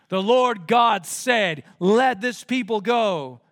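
slow, emphatic demand. Acted. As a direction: lay down the command slowly. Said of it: "they were making demands".

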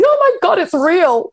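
squealing high with disbelief; high thrilled exclamation; high delighted surprise. Retold or imitated. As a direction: gush like a kid at a parade.

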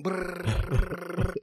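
long rolled brrr; buzzing brain noise. Retold or imitated. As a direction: imitate a brain whirring away.

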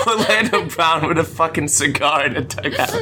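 picturing it and cracking up; real giggly retelling; laughing. Real, not acted. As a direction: talk through tearful laughter. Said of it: "You guys are still laughing about it".

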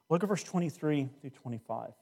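usual speaking voice

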